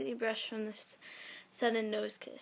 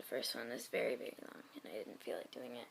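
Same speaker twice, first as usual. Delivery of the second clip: much lower and softer trailing aside; muttered aside; 11 semitones lower, 11 dB quieter than usual. Real, not acted. Explaining a skipped fanfic, a low muttered aside. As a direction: mumble it to yourself. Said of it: "muttered aside".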